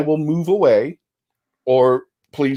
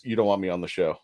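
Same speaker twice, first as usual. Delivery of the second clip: low, soft, understated protest; mumble-low, reluctant brush-off; low-key retold refusal; 8 semitones lower, 7 dB quieter, faster than usual. Guest retelling a refusal to Joe, low and subdued.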